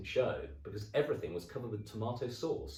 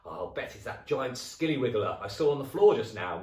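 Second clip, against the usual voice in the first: sly husband; 5 semitones higher, 9 dB louder than usual. Acted. Husband teasing frightened wife.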